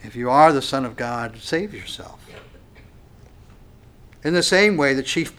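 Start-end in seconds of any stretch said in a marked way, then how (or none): none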